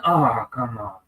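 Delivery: ordinary talk, nothing marked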